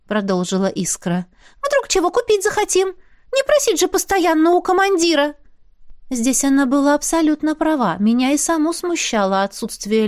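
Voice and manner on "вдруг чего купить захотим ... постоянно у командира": high, squeaky little spirit, businesslike. Acted. Spirit talking business with the heroine, high squeaky voice. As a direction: pipe it up like a small sprite.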